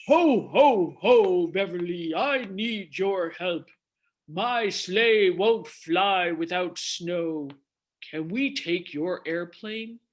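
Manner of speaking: jolly, booming Santa, slow; big hearty ho-ho-ho, then ask gently; Santa Claus. Acted. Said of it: "he said".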